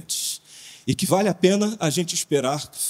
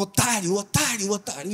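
retold insult shout; 5 semitones higher, 3 dB quieter than usual. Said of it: "e gritava".

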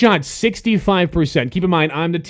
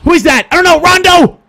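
high yell building to a long howl; loud, high, anguished game yell; exasperated shout; 13 semitones higher, 5 dB louder than usual. Real, not acted. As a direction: holler the name, stretching it out. Yelling at his player.

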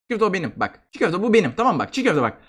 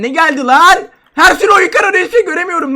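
startled outcry; 7 semitones higher, 6 dB louder than usual. Real, not acted. Game screen suddenly goes dark.

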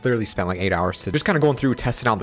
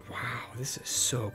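whispering; 2 semitones higher, 9 dB quieter, much slower than usual. Real, not acted. Awed by game detail.

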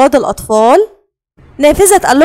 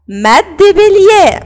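slow, stretched مد بالياء for the class; teacher stretching بالياء; drawn-out answer; 4 semitones higher, much slower than usual. Shown as a demonstration. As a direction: draw out بالياء slowly like a teacher. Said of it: "ميعادنا مع ايه بقى".

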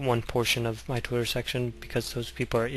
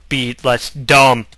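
mock loud jab; 11 dB louder, slower than usual. Mock insult in a hashtag bit.

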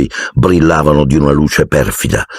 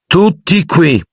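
a commanding call, much higher and in a different voice; stern order; 13 semitones higher, slower than usual. A narrator's reading. Snape summoning the class to the toad, commanding. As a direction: summon the whole class sharply. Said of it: "ordinò Piton".

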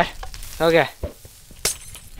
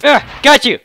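ordinary talk, nothing marked

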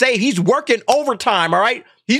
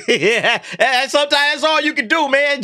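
laughing; 6 semitones higher than usual. Real, not acted. Laughing at the tweet.

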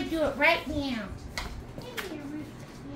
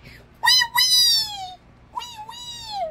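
very high squealed toy wail; pretend crying; much slower than usual. Toy frog crying for Hannah, squeaky pretend wail. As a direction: squeal a drawn-out fake "wee wee" cry. Toys voiced crying in play.

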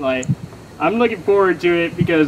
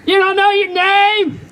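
hammed-up deaf act, overloud; mock hard of hearing; 12 semitones higher than usual. Comic quizzing a shy audience member, mock deaf shouting.

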